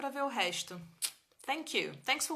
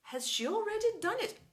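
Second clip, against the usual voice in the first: high rising already in has she already done it?; eyes wide open, amazed question; wide-eyed surprise; 10 semitones higher than usual. Shown as a demonstration. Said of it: "Abra os olhos, arregala, põe a entonação aí". (surpresa, olhos arregalados, tom alto)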